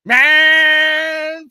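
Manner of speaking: high, loud mock hype; hyped yell. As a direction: hype up the junk ride. Hyping the lawnmower car.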